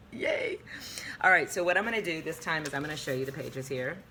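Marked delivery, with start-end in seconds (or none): 0.1-0.6 squeaky high fake cheer; sarcastic yay. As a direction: cheer like you dread it. Two long chapters ahead.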